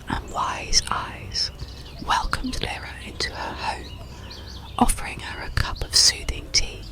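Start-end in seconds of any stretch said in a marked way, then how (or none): none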